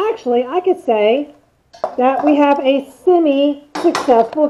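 muffled behind face mask; speaking through a respirator. Real, not acted. Seen 1: Cartridge respirator covers mouth before, during and after; camera briefly on pepper.

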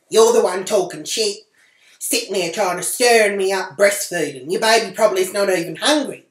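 loud, heated comeback; angry retort. Retold or imitated. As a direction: tell her off, voice rising. She yells back at woman.

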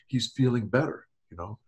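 ordinary talk, nothing marked

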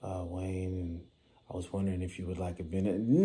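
hesitant, mumbled, unsure salesman; timid pitch. Retold or imitated. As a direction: play a nervous rookie stammering through his intro. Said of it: "don't get up in there and be like".

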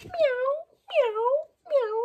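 very high little meows; cat meowing. Acted. Cat answering the dog, high meows. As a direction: mew like a small cat.

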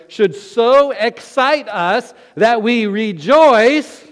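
slow, loud, rising preacher's cry of praise; full-force preaching. Acted. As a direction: proclaim the reward in heaven with all your might.

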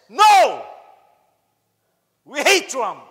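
loud, high shout of protest; screaming. Retold or imitated. Filipina in the elevator shouting at French tourists, screaming. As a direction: yell it high and angry. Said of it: "is screaming and say".

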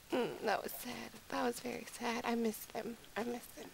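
soft, wistful murmur; sad. Real, not acted. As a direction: say it quietly, missing lost pets. Remembers the lost big cats.

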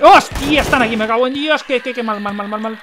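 high, loud yelp tumbling into panicked repetition; real jump-scare outburst, high and loud; startled. Real, not acted. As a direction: burst out in sudden panic, then rattle on.

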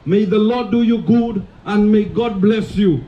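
usual speaking voice

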